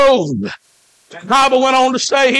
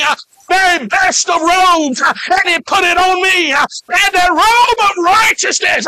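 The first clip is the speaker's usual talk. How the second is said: higher, shouting testimony, breathless "uh" before each phrase; full-force preaching; 4 semitones higher than usual. Acted. Preacher shouting his conversion testimony to the church, full-force preaching. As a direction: preach your salvation story with fire.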